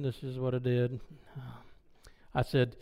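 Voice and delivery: ordinary talk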